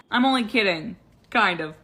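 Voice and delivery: half take it back with a grin. Real, not acted. Teasing after laughing.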